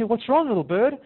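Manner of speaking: high-pitched acted concern; concerned cooing. Person asking the crying little bird what's wrong, high concerned cooing. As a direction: coo a worried question to a small pet.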